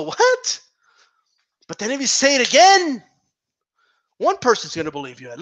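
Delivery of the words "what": high, rising disbelieving what; baffled what. Retold or imitated. Crowd hearing a wild claim, high disbelieving what. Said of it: "Everybody's gonna go".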